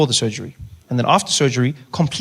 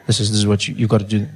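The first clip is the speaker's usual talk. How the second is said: low, hummed murmur, not quieter; confidential voice; 6 semitones lower than usual. Shown as a demonstration. Showing how to talk everywhere, low confidential voice. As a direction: drop the pitch into a confidential hum. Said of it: "you speaking very softly, very hum".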